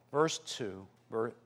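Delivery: ordinary delivery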